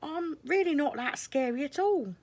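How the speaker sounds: high, friendly, smiling voice; smiling caterpillar. Acted. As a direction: reassure with a smile in the voice. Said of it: "he added with a smile".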